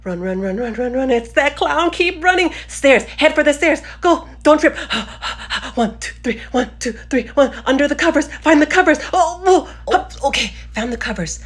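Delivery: loud high panicked running; being chased. Acted. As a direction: flee for your life, shouting. Fleeing the clown.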